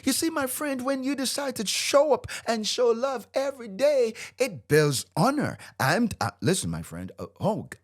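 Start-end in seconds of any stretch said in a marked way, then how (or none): 0.0-5.6 high-pitched, impassioned preaching; worked-up teacher driving each point home; fired up. Real, not acted. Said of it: "I get fired up about this so much".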